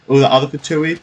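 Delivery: ordinary talk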